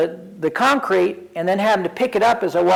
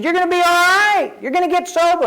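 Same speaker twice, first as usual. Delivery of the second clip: emphatic reassurance; 12 semitones higher, 4 dB louder than usual. Retold or imitated. Friend repeats his reassurance.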